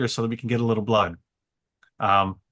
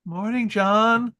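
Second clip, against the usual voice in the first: higher, slow, timid hello; sheepish greeting; 10 semitones higher, much slower than usual. Retold or imitated. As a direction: ease in sheepishly, drawn out and high. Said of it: "now I'm sheepishly going up to John".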